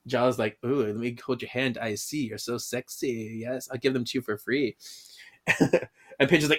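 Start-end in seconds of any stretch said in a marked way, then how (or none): 0.6-4.8 put-on low, soft, seductive French accent; hushed mock French come-on; bad French accent.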